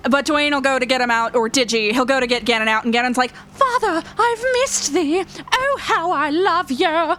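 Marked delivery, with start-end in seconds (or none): 3.5-7.1 doting pet voice. Pretend. Pet greeting its father, high and theatrically adoring. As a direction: swoon over father like an old-time lover.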